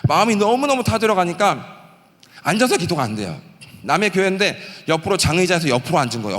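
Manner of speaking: normal talking voice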